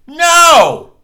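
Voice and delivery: a loud, high shouted No. Retold or imitated. Losing it with the phone representative, a loud shouted no. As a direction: explode at him.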